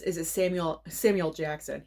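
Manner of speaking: ordinary delivery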